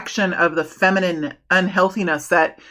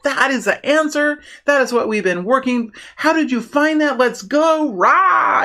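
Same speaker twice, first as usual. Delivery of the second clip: high-pitched, thrilled colleague cheering a new idea; getting excited; 5 semitones higher than usual. Acted. Reader acts out reaction to a new idea at work, getting excited. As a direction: build eagerly to a rousing "Rah!". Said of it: "I know I'm getting excited here".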